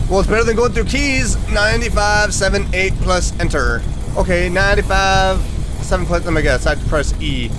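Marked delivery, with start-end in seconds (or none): none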